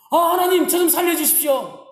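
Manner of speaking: high-pitched mock begging for rescue; acted desperate plea. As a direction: beg God to save you. Dangling man begs God. (높은 목소리로 다급하게 애원하는 연기)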